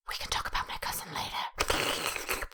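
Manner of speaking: hushed, voiceless aside; whispered aside. Acted. Putting off talk of a cousin until later, whispered aside. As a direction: whisper it low and secretive. Aside to one person amid chat.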